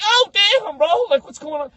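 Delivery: high, loud mock alarm; startled reaction. Visitor reacting to zombies jumping out, startled.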